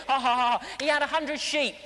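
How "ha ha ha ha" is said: acted ha-ha of an amused crowd; mock laughter. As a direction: pretend to laugh at a great joke. Imitates the joke's audience laughing.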